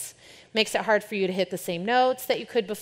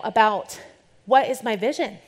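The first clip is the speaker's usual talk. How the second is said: ordinary talk, nothing marked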